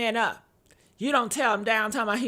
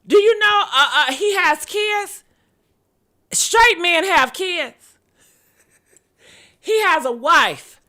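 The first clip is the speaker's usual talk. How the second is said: mock outrage; 6 semitones higher, 7 dB louder than usual. Mock defense of [name].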